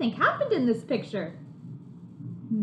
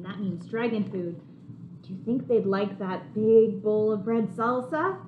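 usual speaking voice

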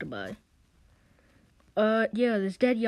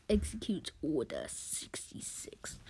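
low, slow, hushed command; mock-menacing quiet villain voice; sinister villain order; 6 semitones lower, 7 dB quieter than usual. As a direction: whisper the order like an evil overlord. Villain gives his order.

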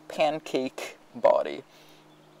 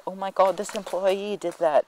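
higher mock Karen voice; 7 semitones higher than usual. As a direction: play the entitled customer blaming staff. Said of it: "the Karen".

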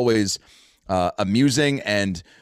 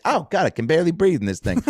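higher mock Houston twang; thick Houston accent; 6 semitones higher than usual. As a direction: complain as the Houston woman, in her accent. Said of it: "someone with a thick accent like that".

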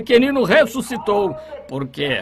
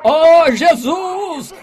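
much higher, louder, slow stretched cry; drawn-out shout; 7 semitones higher, 7 dB louder, much slower than usual. Retold or imitated. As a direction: stretch the call out as loud as he can. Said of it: "Ele gritou".

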